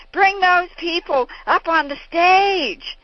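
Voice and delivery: high, loud, slow booming command; commanding father. Retold or imitated. Father calling the crowd up onstage, booming and slow. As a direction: call out to the whole hall from the stage. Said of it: "and he said".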